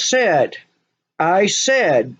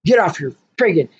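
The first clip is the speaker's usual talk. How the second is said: usual speaking voice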